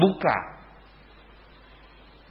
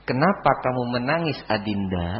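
soft, lowered voice to a crying wife; tender husband; 4 semitones lower, 5 dB quieter than usual. Shown as a demonstration. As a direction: ask gently, sinking softer on the endearment. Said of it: "gitu caranya". (suami bertanya lembut kepada istri)

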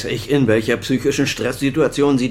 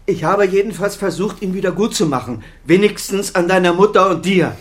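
defensive outburst; 8 semitones higher, 4 dB louder than usual. Acted. Father defends himself to son.

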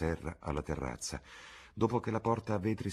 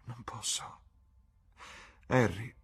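sad; 4 semitones higher than usual. A narrator's reading. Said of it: "rispose Dorian tristemente".

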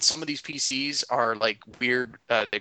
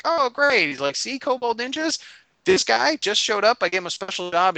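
gushing cult leader; 10 semitones higher, 5 dB louder than usual. Retold or imitated. Dragon Man bragging to the kobold ninjas, high gushing voice. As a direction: show off the new recruit to the ninjas, high and grand.

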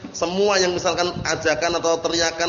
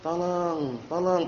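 a quiet, unhurried call for help; soft call for help; 6 dB quieter, much slower than usual. Retold or imitated. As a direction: say tolong softly, not shouted. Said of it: "pelan-pelan, enggak keras".

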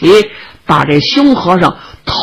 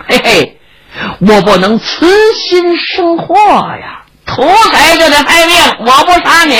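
put-on loom over the child, voice rising as you threaten; monaco assassino minaccioso; 6 semitones higher than usual.

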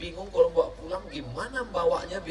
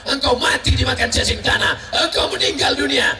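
louder and higher, warning of death; preaching shout; 7 semitones higher, 6 dB louder than usual. Real, not acted. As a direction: hammer it down to the crowd. Preaching at full force. (seruan khotbah keras)

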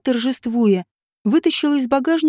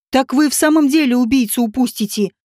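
ordinary delivery

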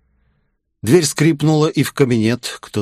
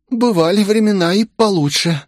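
exhaled; 11 semitones higher than usual. A narrator's reading. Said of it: "выдохнула она".

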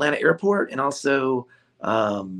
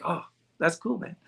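lightly imitated Hawaiian friend, quieter; a soft, rough attempt at a Hawaiian accent; bad Hawaiian accent; 3 semitones higher, 6 dB quieter than usual. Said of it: "Sorry for my bad, um, Hawaiian, uh, accent".

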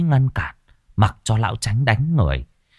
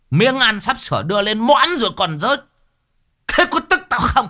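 furious tirade; 11 semitones higher than usual. A narrator's reading. Old master Chánh raging at son Trần over the lost fortune, fast shrieking fury. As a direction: lash out at the son, rising to the top.

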